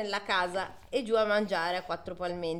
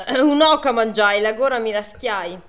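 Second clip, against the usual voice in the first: loud taunt; 8 dB louder than usual. Acted. Young man teases from outside.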